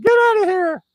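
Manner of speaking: high, squawky crow voice; imitation bird shrieking a warning; screaming crow. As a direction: screech the words like a crow.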